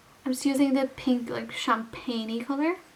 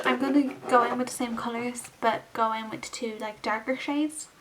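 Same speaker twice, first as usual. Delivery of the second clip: usual speaking voice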